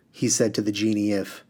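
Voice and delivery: ordinary delivery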